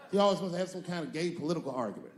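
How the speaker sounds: ordinary delivery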